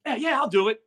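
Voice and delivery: high, eager show of bravado; acted bravado. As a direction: retell it as a mock-brave, too-quick agreement. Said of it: "But I'm acting all bad, like, I ain't afraid".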